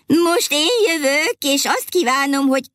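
high, eager cry cut off mid-word; fairy shouting. A narrator's reading. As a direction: shout it out before being silenced. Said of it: "kiabált egy másik tündér". (magas, lelkes kiabálás, félbeszakítva)

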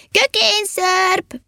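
high, drawn-out holler; blaring shout. A narrator's reading. Elf shouting into the silence, high blaring call. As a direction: sing out the call high and long. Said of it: "és egyre harsogott".

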